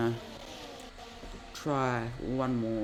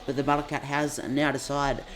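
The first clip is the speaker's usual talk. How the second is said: motorsport commentator; 4 dB louder than usual. Acted. Live race play-by-play.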